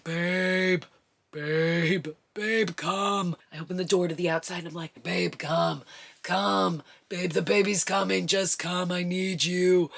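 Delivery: loud, strained calling out; urgent call. Retold or imitated. Woman in labor calling her husband inside, loud and urgent.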